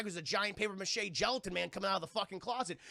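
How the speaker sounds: ordinary delivery, nothing marked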